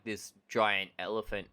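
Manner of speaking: ordinary talk, nothing marked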